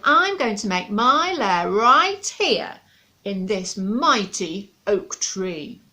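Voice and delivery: loud, slow dragon claim; stubborn dragon. Acted. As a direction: drag out every word with menace.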